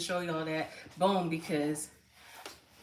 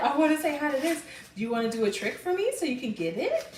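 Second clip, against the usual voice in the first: a lightly voiced dog, higher and louder; voicing the dog; 8 semitones higher, 3 dB louder, faster than usual. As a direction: speak for the dog in a cute pet voice. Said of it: "She said".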